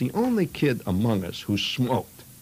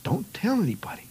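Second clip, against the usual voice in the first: whispered, pitched-up warning; conspiratorial whisper; 13 semitones higher than usual. Retold or imitated. Boy swearing his friend to secrecy, whispered. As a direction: hush them like a secret keeper.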